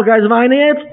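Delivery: high-pitched mock indignant question; mock indignation. Spouse challenged about crying, high mock indignation. Retold quarrel over crying.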